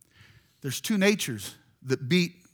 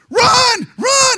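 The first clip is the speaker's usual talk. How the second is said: full-throated shout, much higher and louder; yelling run; 13 dB louder than usual. Retold or imitated. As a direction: scream it across the ballfield. Team yelling at batter.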